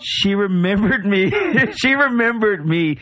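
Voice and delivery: high, drawn-out giddy delight; excited squeal. Real, not acted. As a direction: savor it like a thrilled fan. Excited repeat, high and slow.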